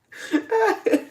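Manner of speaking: short high laugh. Real, not acted. High laugh admitting to lots of community service. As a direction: giggle high at yourself.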